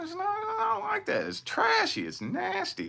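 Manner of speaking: very high, disgusted squeal. Real, not acted. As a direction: shriek out the disgust. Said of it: "disgust in high voice".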